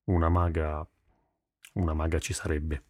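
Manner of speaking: low-pitched, halting admission; hesitant. A narrator's reading. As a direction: admit it reluctantly, pausing after the first attempt. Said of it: "disse esitante".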